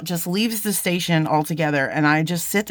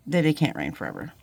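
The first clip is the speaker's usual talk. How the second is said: "that it can't rain forever" said softer and lower; soft promise; 5 semitones lower, 3 dB quieter than usual. Real, not acted. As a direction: let the promise settle gently. Encouraging viewers, repeated line.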